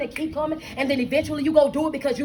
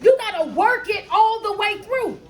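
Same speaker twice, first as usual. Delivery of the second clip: raised, higher-pitched exhortation; emphatic preaching; 6 semitones higher than usual. Real, not acted. Coach urging listeners through every step, preaching hard. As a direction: drive each word home like a preacher.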